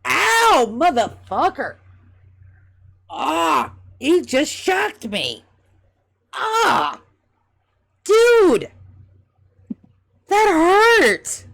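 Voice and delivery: high, loud pained yelps; [name] yelling in pain. Acted. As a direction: howl after a sudden shock.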